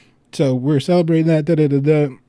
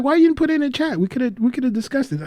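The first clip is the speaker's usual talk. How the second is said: mock protest; 9 semitones higher, faster than usual. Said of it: "I was like".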